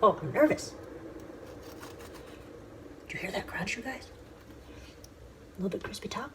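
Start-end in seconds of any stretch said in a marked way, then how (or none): 3.1-6.3 hushed. Real, not acted. Hushed while slicing the loaf.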